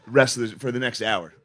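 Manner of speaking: usual speaking voice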